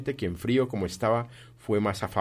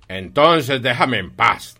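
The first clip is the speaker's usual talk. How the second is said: loud, slow, drawn-out annoyance; irritated demand; 3 semitones higher, 10 dB louder, slower than usual. Acted. Scrooge dismisses his nephew in their quarrel, loud and irritated. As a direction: push each word out hard and slow.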